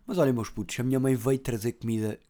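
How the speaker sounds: ordinary delivery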